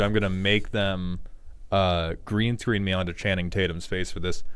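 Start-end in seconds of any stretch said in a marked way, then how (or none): none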